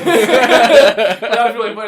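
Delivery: high, loud, slow, dissolving in laughter; real laughter; laughing. Real, not acted. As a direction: squeeze it out mid-laugh. Laughing hard.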